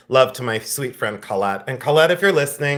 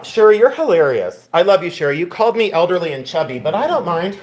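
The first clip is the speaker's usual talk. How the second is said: excited; 6 semitones higher, 3 dB louder than usual. Real, not acted. Excited after a laughing fit.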